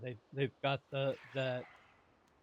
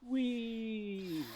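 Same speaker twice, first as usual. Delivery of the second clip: high, delighted squeal of fun; gleeful whee; 8 semitones higher than usual. Real, not acted. Player leaping off a ledge, gleeful high whee. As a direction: let out a playful high whee.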